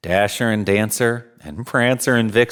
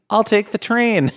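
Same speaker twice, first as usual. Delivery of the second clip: delighted; 11 semitones higher than usual. Acted. Santa delighted with his idea.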